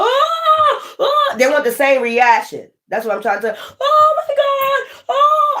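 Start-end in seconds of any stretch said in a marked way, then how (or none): 0.0-1.3 very high, wailing oh's; mock wail. Retelling her grandfather's funeral, a high mock wail. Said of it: "I was on the ground crying". 3.8-5.6 very high, slow, crying wail; mock wail. At grandfather's casket, a high slow crying wail. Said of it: "I was on the ground crying".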